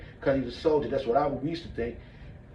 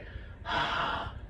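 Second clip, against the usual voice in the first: long forceful exhale through the mouth; breath thrust out; 7 dB quieter than usual. Shown as a demonstration. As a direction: blow the breath out hard. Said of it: "look, my breath went".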